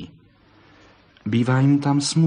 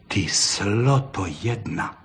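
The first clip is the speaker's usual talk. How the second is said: low, slow scolding of the fox; an old forester's wife cursing the vixen; scolding old woman; 3 semitones lower, 4 dB quieter, slower than usual. A narrator's reading. Forester's wife scolds the vixen, low and slow. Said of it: "spustila stojíc uprostřed slepičího hřbitova".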